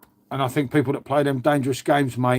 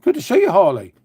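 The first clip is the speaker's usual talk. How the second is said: much higher and louder, calling out; excited greeting; 3 semitones higher, 6 dB louder than usual. Real, not acted. Vlogger calls out to friend Harley, excited greeting. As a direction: hail him warmly across the room.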